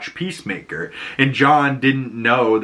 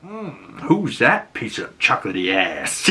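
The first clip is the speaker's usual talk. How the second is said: lightly voiced lecherous purr; leering Flurry. Flurry ogling Adebayo through the van mic, lightly leering. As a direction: savor it like a creep. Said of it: "He's like".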